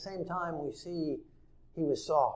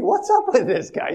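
lightly higher, puzzled brother's question; baffled brother; 9 semitones higher, 8 dB louder than usual. Retold or imitated. Brother repeats his puzzlement, only slightly raised. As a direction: ask it flatly puzzled, less squeaky.

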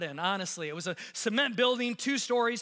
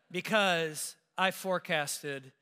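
ordinary delivery

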